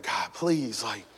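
low, hushed begging to God; soft, urgent repeated pleas; retold desperate prayer, kept very quiet; pleading prayer. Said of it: "begin to pray".

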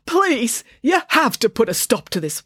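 high, desperate plea; urgent pleading with a high opening word. A narrator's reading. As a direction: beg them to stop, pitch jumping up. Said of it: "pleaded the manager".

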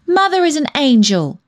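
high, heated protest; hot indignation. A narrator's reading. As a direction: defend her hotly, pitch jumping up. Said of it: "said I hotly".

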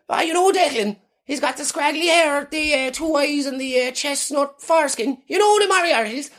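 high-pitched gossip; nosy local voice. Pretend. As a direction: gossip like a village busybody.

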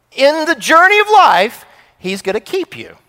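normal talking voice

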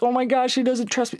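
a heart breaking a little; mild mock dismay, high; hurt. Father's hurt inner voice.

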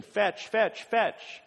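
high, mock congregation chant-yell; imitated crowd yell. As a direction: call it out like a whole congregation. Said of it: "congregation to yell in unison".

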